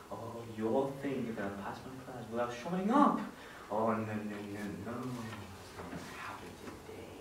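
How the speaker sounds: low, very soft villainous musing; hushed, low self-talk with menace; gloating soliloquy. Acted. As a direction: mutter the scheme to yourself. Villain gloats alone, plotting against kids.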